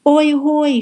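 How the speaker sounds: high, loud hailing call; megaphone shout. A narrator's reading. Pete hailing through a megaphone, shouting. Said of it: "shouted Pete through a giant megaphone".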